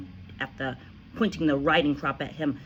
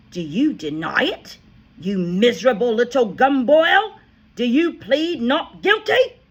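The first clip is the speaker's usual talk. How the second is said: loud, higher accusing questions; furious headmistress cross-examines boy; interrogating; 8 semitones higher, 10 dB louder than usual. A narrator's reading. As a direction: grill him like a prosecutor.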